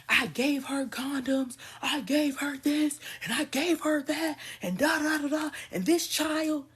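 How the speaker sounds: high, loud whiny mimic of the man; mocking a clueless man. Mocking a man on Facebook, high, loud and whiny. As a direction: mimic him pleading his case, high and loud. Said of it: "He's literally like".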